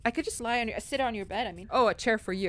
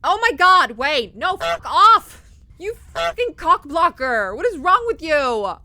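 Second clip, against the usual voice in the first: much higher, very loud, slow outraged shout; furious yell; 9 semitones higher, 14 dB louder, slower than usual. Real, not acted. Players yelling at Wayne for interrupting the kiss, furious shout. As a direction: yell at the intruder.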